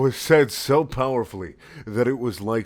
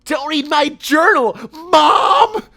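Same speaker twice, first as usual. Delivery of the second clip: put-on much higher, louder teen yell; screaming; 10 dB louder, slower than usual. Sonic screaming at his mom about his journal, high and loud. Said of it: "screamed Sonic".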